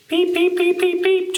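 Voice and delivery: high, piping frog peeps; squeaky, rapid little peeps; spring peeper. Pretend. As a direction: peep like a tiny tree frog. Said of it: "I've heard lots of spring peepers".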